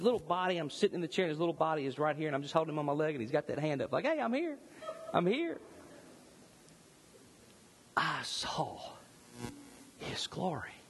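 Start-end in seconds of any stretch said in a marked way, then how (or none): 4.0-5.6 put-on much higher cute baby voice; voicing the baby. Voicing newborn son's greeting. 8.0-10.7 breathless hushed whisper; awed whisper. Real, not acted. Awed climax about his son.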